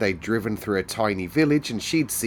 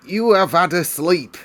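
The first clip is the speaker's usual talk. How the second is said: high, loud housekeeper voice, discarded take; an attempt at Mrs Medlock that misses; first try at Mrs Medlock; 9 semitones higher, 7 dB louder than usual. Narrator's rejected first try at Medlock, high and loud. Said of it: "That's not Missus Medlock's voice".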